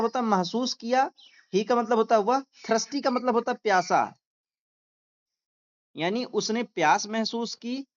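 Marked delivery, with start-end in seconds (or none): none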